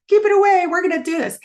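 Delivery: high, loud, fired-up cry; rally yourself against the bread basket; determined shout. Retold or imitated. Telling viewers how she refused the biscuits, a high loud rallying cry. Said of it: "I was like".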